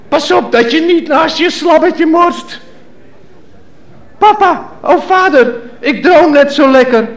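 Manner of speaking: put-on child voice. Child pleading with mother and father, whining. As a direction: whine like a small child to his parents.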